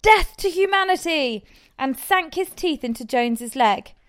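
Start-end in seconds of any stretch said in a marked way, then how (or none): 0.0-1.4 high, loud war cry; hammed-up rallying yell; battle cry. A narrator's reading. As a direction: shout it as a charge. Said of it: "sprang forward with a cry of".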